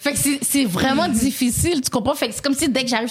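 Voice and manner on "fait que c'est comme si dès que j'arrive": ordinary delivery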